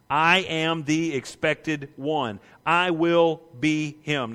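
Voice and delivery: slow, deliberate voice of Jesus; Jesus declaring himself, slow and weighty; emphatic declaration. Acted. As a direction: lay each word down slowly and firmly. Voicing Jesus' claim emphatically.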